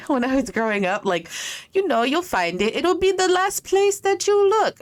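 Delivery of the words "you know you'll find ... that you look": high-pitched mock mom voice; mom impression. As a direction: imitate mom reciting her irritating stock phrase. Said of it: "she would just constantly give me".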